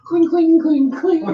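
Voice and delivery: high sing-song cling cling; wind chime imitation. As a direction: chime like little bells, laughing.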